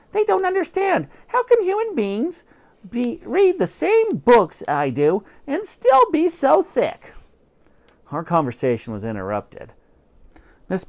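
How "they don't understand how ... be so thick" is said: high, loud scornful whine; high disdainful cat voice; exasperated cat. Acted. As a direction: despair at how thick humans are. Cat complains about humans.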